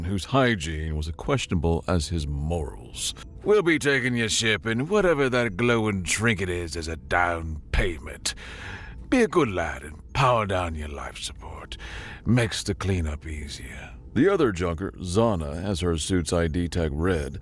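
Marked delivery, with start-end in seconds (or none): 3.4-13.9 sly, mocking pirate captain; half-whispered oily threat; false friendly pirate. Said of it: "dripping with false bonhomie".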